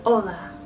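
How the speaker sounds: Hola, silent H, oh-la; Hola with no breath at the start; Spanish hola. Shown as a demonstration. Teacher repeating the greeting, silent H. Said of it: "Really simple".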